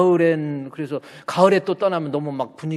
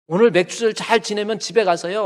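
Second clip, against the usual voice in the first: ordinary talk, nothing marked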